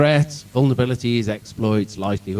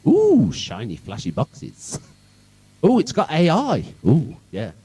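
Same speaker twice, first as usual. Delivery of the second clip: exaggerated oohing with swooping pitch; sarcastic wide-eyed wonder; mock awe; 4 semitones higher, slower than usual. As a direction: gush like a dazzled buyer. Mocks buyers dazzled by AI products.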